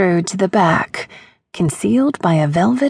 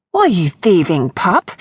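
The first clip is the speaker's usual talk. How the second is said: ordinary delivery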